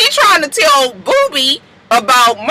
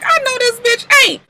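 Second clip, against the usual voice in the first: incredulous Brooke; 13 semitones higher than usual. Retold or imitated. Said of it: "Brooke looking from background like".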